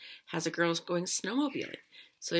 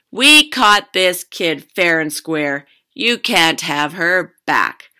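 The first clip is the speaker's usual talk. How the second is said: loud, slow storybook fish; big fish refusing; 17 dB louder, much slower than usual. A narrator's reading. Big fish refusing to return the girl, loud and slow. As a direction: answer back loudly, word by word.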